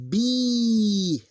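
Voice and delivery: teacher drawing out the long vowel. Shown as a demonstration. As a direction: stretch B then breathe hat. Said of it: "that's a long sound right there".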